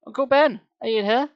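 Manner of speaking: loud, high searching call; shouting. A narrator's reading. Gabe looks for his uncle in the tomb, shouting. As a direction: call out to someone hidden. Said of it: "I shouted too".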